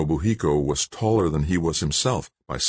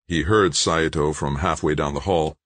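usual speaking voice

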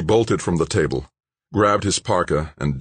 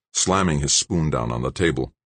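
ordinary delivery

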